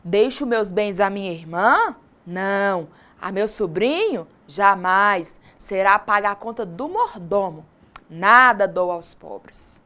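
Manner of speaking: "irmã?" rising, "Não." falling flat, "sobrinho?" then a firm "Jamais."; read as questions and answers. Shown as a demonstration. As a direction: ask "Deixo meus bens à minha irmã?" then answer "Não." and "Jamais." in short falls. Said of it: "Vejam como ficou".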